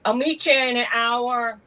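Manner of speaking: mock New York accent, slowed right down. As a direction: imitate a New York guy, stretching the words. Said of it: "one guy was like".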